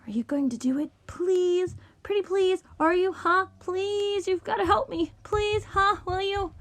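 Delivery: high, insistent begging; pleading whine. A narrator's reading. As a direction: beg in a small high voice, piling up pleas. Goldberg begs Bach for help.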